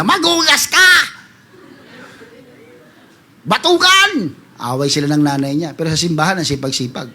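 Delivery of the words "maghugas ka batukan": high, sharp imitating of a scolding parent; mock parent yell. Parent yelling at the lazy child at home, mock scolding. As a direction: bark it like a fed-up mother.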